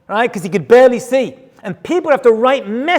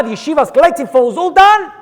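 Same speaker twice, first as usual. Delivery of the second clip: ordinary talk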